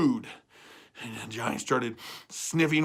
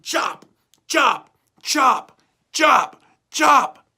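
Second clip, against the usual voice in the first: each chop higher and louder; 10 semitones higher, 8 dB louder, much slower than usual. A narrator's reading. Narrator voicing Jack chopping the beanstalk, loud. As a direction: hack the words like swings of an axe.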